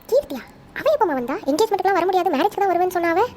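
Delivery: high-pitched, astonished questioning; surprised. Acted. Relative hears Keerthi has already arrived, high-pitched surprise. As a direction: react to unexpected news, voice jumping up.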